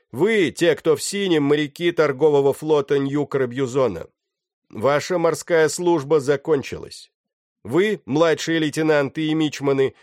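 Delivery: high, loud proclamation to a crowd; shouted address. A narrator's reading. Pirate dismissing the captive sailors, shouting his announcement. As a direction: declaim so the whole deck hears. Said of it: "прокричал человек".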